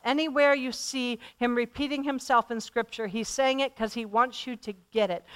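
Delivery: ordinary talk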